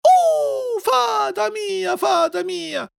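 very high, drawn-out wail; panicked screaming. Acted. Puppet screaming for the fairy, panicked. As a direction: call desperately for help. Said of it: "saccò allora a gridare lo pupu".

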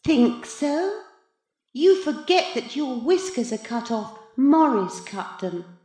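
high, knowing, superior voice; lofty cat in boy's body. A narrator's reading. The other reminds Maurice of his cut whiskers, loftily. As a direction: deflate his plans condescendingly.

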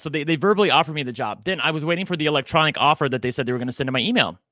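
ordinary delivery

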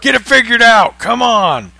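loud teasing shout at a cohost; mock yelling. Real, not acted. Joking yell at cohost.

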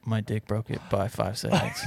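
soft, deadpan macho boast; manly man. Pretend. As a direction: quietly brag as the tough guy. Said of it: "the guy that you're doing right now".

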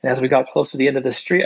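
ordinary talk

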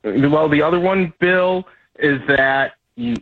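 slow, halting, searching for the line; losing my place. Real, not acted. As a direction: stall while finding the place. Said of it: "lost my place for a second".

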